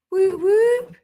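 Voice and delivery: high loud whoop whoop; excited whoop. Real, not acted. Host greeting a viewer from New Jersey, excited whoop. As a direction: celebrate them calling in.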